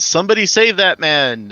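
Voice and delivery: high, loud in-character call; yelling out. Acted. Player's character yells to the party for help, calling out loudly. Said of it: "And I yell out".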